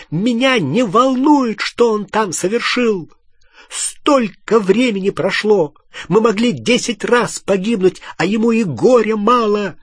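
high-pitched, insistent angry tirade; outraged ranting. Acted. Hermione furious that Ron stayed away, outraged ranting. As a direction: rant on without softening. Гермиона продолжает кричать.

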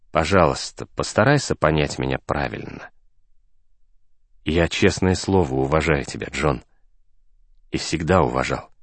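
lightly voiced mentor, pitched low; calm, patient mentor. A narrator's reading. Narrator voices Julian gently explaining himself. (слегка обозначенный спокойный наставник, низким голосом)

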